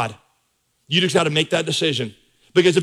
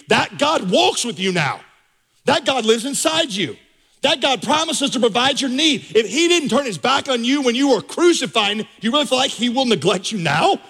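building fervent sermon, pitch climbing higher line by line; preaching crescendo; 7 semitones higher than usual. Acted. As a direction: rise higher with each claim about God.

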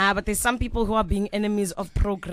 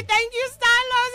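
sing-song thanks; 12 semitones higher, slower than usual. Retold or imitated. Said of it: "I was literally about to say".

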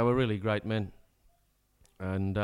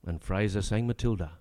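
rollicking, higher-pitched verse delivery; comic ballad recitation; 6 semitones higher than usual. Acted. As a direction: rattle off the ballad with a showman's swing.

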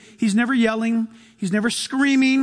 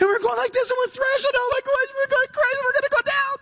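hammed-up panicked screaming. Preacher acting out emotions screaming at you, high-pitched mock panic. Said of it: "throne of your emotions screaming at you".

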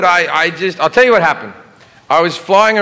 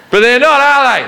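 higher Aussie guard voice; Australian accent; 8 semitones higher, 4 dB louder than usual. Retold or imitated. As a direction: question it like a wry Aussie guard. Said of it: "And he went".

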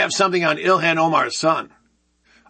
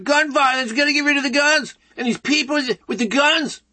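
high-pitched mock alarm; mocking imitation; 8 semitones higher than usual. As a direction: imitate hand-wringing activists in a raised, agitated voice. Mocking anti-gun people's talk.

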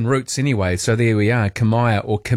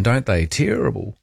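usual speaking voice

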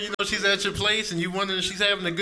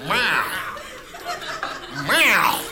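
hungry cat meows; 4 semitones higher than usual. Acted. Hungry cat complaining to its owner, yowling. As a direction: meow in indignant complaint. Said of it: "cats are yelling at you when they're hungry".

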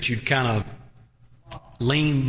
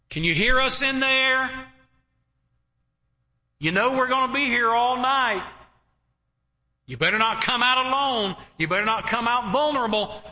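taunting enemies; 7 semitones higher than usual. Acted. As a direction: taunt the camp from outside the walls. Preacher voices taunting enemies at night.

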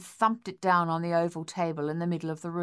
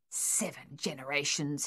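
softer, low muttering; 3 semitones lower, 6 dB quieter than usual. A narrator's reading. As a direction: mutter to himself. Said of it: "he muttered".

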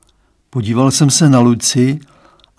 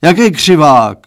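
louder bellowed question; dad bellowing at his daughter; roaring; 3 semitones higher, 7 dB louder than usual. A narrator's reading. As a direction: bellow it in confusion. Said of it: "zařval pan Stránský".

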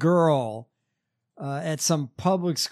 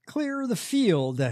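slow, higher mock sermon line; mock radio preacher; 7 semitones higher, slower than usual. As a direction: draw it out like a pompous radio host. Mocking the radio host's catchphrase.

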